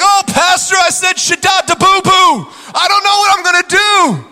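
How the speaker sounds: high, loud, hammed-up panic to the pastor; panicked member. Congregant fretting to the pastor, hammed-up high panic.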